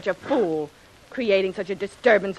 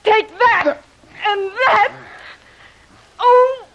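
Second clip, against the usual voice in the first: loud, high, tearful blows; angry shouting; 9 semitones higher, 5 dB louder, slower than usual. Acted. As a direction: lash out through tears. Woman hitting the man back.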